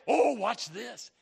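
loud, high mock excitement; drawn-out, hammed-up "look at me" voice; imitated showing off. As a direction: mimic someone eagerly starting a séance trick. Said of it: "they'll say".

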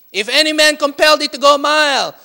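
high, loud declaration of the verse; proclaiming scripture. Acted. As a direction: ring it out like a preacher. Quoting Jesus' teaching again.

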